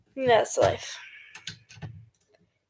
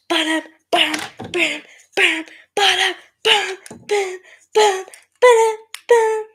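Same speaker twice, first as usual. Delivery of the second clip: punchy ba-dums climbing higher; imitated beat; 5 semitones higher, 6 dB louder than usual. Filling after a freeze, rising beat noises. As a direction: pulse loud "ba-dum" pairs, building upward.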